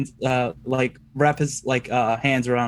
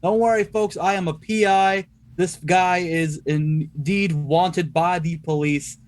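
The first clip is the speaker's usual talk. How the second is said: higher, deliberate declamatory voice; playing the detective; 5 semitones higher than usual. Detective to captive and worried folks, raised official announcement. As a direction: speak up officially for onlookers.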